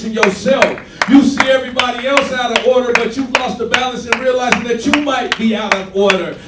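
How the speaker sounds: loud, driving sermon delivery; preaching at full force. Acted. Preacher exhorting congregation, loud full-force preaching. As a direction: hammer the point home to the church.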